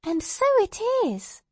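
put-on very high little-girl voice; high-pitched girl noticing something; child. A narrator's reading. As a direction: exclaim like a small child. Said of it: "said the child".

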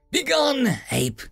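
high, slow sneer. A narrator's reading. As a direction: sneer it with contempt. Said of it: "the elf god sneered".

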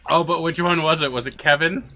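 high and loud, gleeful wondering; excited. Real, not acted. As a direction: ask it with giddy glee. Excited mock speculation, louder.

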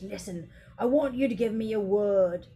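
ordinary delivery

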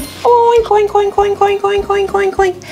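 high, bright mother pig greeting; mama pig voice. Pretend. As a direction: greet the piglet as its mom. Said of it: "Here comes Mama Pig".

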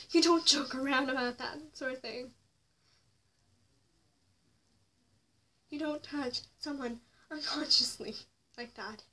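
loud, heated indignation; real anger at what he did, loud; angry outburst. Real, not acted. Angry rant about the assault.

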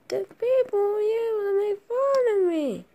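ordinary talk, nothing marked

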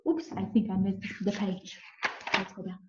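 plain reading voice

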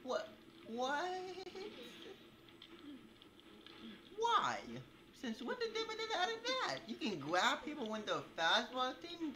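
high, loud indignant questions; high outraged disbelief. Real, not acted. Gamer complaining about a grab, high indignant squeals.

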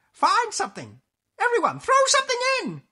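high, loud, eager orders; rally everyone in a high witch's cry; bossy storybook witch in high excitement; excited witch. Acted. Witch eagerly orders her friends.

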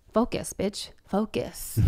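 a soft, throwaway scold; deadpan aside. Acted. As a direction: drop it quietly, flat-out. Punchline scolding trolls.

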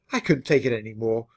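ordinary delivery

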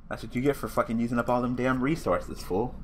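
ordinary talk, nothing marked

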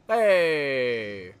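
loud happy hey; excited shout. Real, not acted. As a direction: call out gladly to a friend. Greeting a friendly summon.

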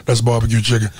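breathy, bassy low mumble; Shaq. Acted. Comic demonstrates how each voice is a note, breathy bassy Shaq. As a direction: drop it low and rumble like a big ballplayer. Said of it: "Shaq is breathy and bassy".

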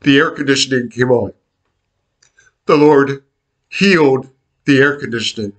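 choked up, with long pauses; fighting tears as the words come; holding back tears. Real, not acted. Telling the congregation the answered prayer, really choked up. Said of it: "I didn't do so well with not crying".

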